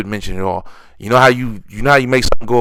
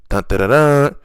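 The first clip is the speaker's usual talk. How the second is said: higher-pitched "dun dun dun" fanfare; mock dramatic sting; 6 semitones higher, 4 dB louder than usual. Announcing next year's matchmaking to viewers, mock dramatic. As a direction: sound out a mock suspense sting.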